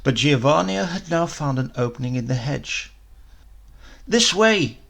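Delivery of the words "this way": put-on a higher, louder child's call. A narrator's reading. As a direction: call her over to the gap in the hedge. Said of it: "he called".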